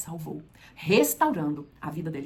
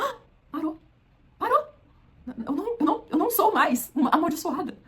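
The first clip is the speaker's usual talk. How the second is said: amazed relief; 9 semitones higher than usual. Acted. Healed woman's amazed realization.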